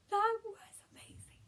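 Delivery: hushed, breathy awe; awed whisper. Real, not acted. Fan whispering over her favorite kill, awed whisper. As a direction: whisper it like you can't believe it.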